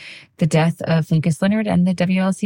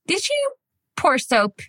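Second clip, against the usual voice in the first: husband's question in a high voice; high, gently asking the child; retelling the husband's question; 5 semitones higher than usual. Retold or imitated. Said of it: "And so he was like".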